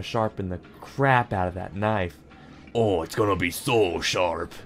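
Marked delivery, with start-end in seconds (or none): 2.7-4.5 low, put-on chef voice; chef impression. Player voicing the chef sharpening a knife, low put-on chef voice. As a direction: play a chef relishing the job, pitched low. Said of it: "That's my chef impression".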